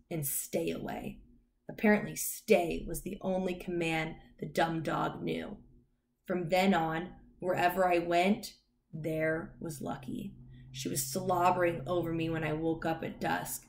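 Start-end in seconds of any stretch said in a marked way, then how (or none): none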